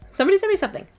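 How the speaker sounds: "Somebody sent me something." pitched high and loud with delight; excited. Retold or imitated. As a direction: squeal it like a kid with a package. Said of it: "It's like".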